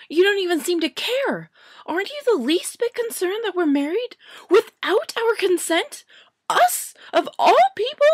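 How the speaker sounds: high, loud, near-shrieking protest; indignant witch at the top of her voice; outraged young witch. Acted. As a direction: demand he do something, outraged.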